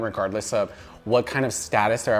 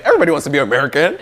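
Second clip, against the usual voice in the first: fast, loud smug voice; mock American boast; 2 semitones higher, 6 dB louder, much faster than usual. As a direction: gush like a proud American. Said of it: "It's like".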